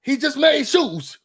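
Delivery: a hint of high excited yelling, slowing at the end; mock his overexcited reaction; imitating his excited yelling. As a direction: friend mocking his fuss over a dull show. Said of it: "what he do be yelling like that".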